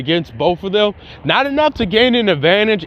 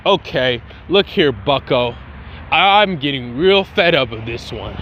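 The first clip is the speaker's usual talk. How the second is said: annoyed android voice; 6 semitones lower than usual. Retold or imitated.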